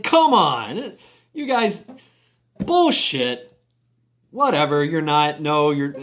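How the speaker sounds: high, loud retold scoff; scoffing dismissal. FBI agent dismissing Steve as a bounty hunter, scornful. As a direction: act out brushing off a ridiculous claim.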